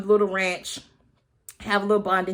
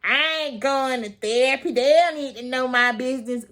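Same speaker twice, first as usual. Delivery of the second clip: mocking skeptics; 3 semitones higher than usual. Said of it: "I'm tired of people saying".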